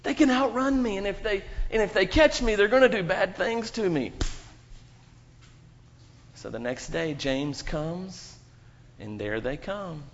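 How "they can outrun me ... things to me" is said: put-on higher, pleading scared kid; frightened kid. James telling friends about bullies, mildly frightened pleading. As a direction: plead that they're too big, a bit panicked. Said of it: "He's like".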